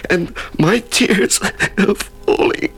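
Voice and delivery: crying. Acted. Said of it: "Do you know I'm crying again?".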